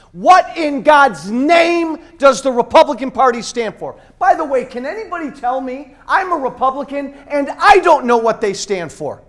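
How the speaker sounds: high, loud, angry yelling; furious shout. Real, not acted. Speaker shouting at the crowd about the Republican Party, furious. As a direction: rail against the party at full voice.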